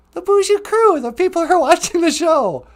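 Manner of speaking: high puppet gushing over the viewers. Acted. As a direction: answer brightly as the puppet. Puppet explains to host.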